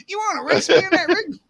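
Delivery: high, loud mock Louisiana accent; hammed-up Louisiana challenge. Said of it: "I can't even touch that".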